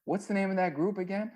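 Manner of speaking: clueless Dirty. Retold or imitated. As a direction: ask it high and innocently clueless. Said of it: "he looks at me and he goes".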